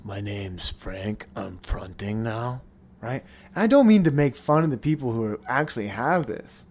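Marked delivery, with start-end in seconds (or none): 0.0-2.6 low, soft, slow put-on alter voice; mock alter taking over, much lower and softer; faked alter switch. Shown as a demonstration. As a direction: drop low and slow like a fake new personality.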